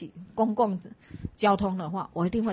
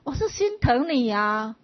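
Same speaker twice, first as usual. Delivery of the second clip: slow, higher, louder pleading; mock heartfelt concern; 6 semitones higher, 7 dB louder, slower than usual. Classmate pleading he only worries for her, slow and earnest. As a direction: lay the pity on thick.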